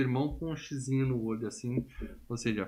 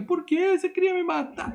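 put-on much higher, frightened child asking; scared kid voice; 5 dB louder than usual. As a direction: squeak it out like a terrified boy. Menino assustado, citação do filho. (menino assustado, voz bem aguda)

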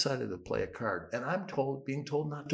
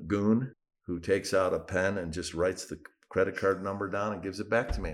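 normal talking voice

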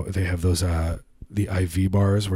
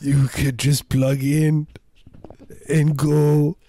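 a higher, slow visionary voice; grand inventor dreaming; 6 semitones higher, slower than usual. Pretend. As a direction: sell the dream like a pompous inventor.

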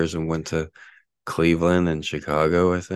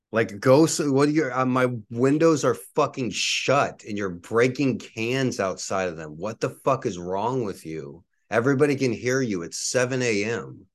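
higher, sharper, really fed up; angry yell; 5 semitones higher than usual. Real, not acted. Shouting at the noisy neighbor through a closed window, furious. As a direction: tell her off for the noise at dawn. Yells again at can breaker.

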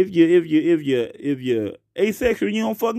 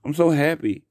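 usual speaking voice

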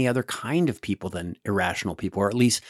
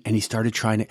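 usual speaking voice

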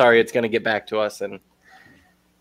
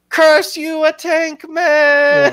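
much higher, drawn-out mock Italian cry; Italian accent; 5 dB louder, much slower than usual. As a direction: shake a fist in a hammy Italian accent. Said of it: "this Italian man".

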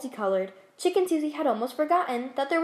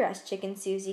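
ordinary delivery, nothing marked

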